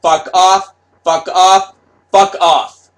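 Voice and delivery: shouted curse at the fans; angry yell. Retold or imitated. Artist yelling at fans, angry shouted curse. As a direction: bark it out, repeated.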